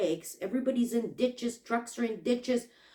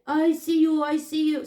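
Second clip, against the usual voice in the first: imitated driver's louder, higher call; 7 semitones higher, 7 dB louder than usual. Delivery driver spots her flashing light, calling out. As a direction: call it out twice, high and urgent.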